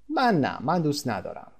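ordinary talk, nothing marked

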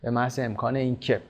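normal talking voice

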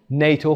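نیتو as one word, "nay-toe". Shown as a demonstration. Teacher repeating for learners the acronym as one word.

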